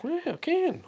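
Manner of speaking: very high squeak on "well, I can"; silly high-pitched squeak. Shown as a demonstration. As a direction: jump way up in pitch. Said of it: "I heard my high-pitched voice again".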